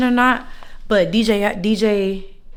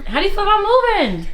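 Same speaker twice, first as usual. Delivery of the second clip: higher, raised repeat of question; calling out; 11 semitones higher than usual. Real, not acted. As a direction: pitch up to get attention.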